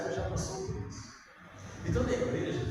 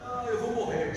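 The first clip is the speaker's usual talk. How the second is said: higher, louder mock sob of a heartbroken youth; mock crying; 8 semitones higher, 3 dB louder than usual. Heartbroken youth lamenting a breakup, in mock crying. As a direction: ham up a broken heart.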